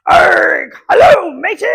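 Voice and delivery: hammed-up pirate shout, high and loud. Shown as a demonstration. Librarian leading kids in a pirate hello, loud shout. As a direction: roar it like a pirate captain. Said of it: "now we're gonna be a pirate".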